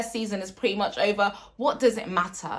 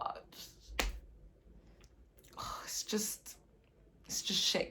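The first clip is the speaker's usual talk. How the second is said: whispered with a sigh, much softer; despairing whisper; 2 semitones higher, 13 dB quieter than usual. Real, not acted. Fan despairing to his viewers, sighing whisper. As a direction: breathe it out, beaten.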